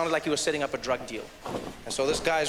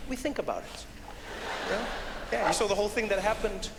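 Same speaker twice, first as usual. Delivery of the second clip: ordinary delivery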